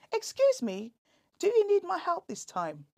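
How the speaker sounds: a touch of a chirpy shop assistant; lightly voiced helpful shop worker.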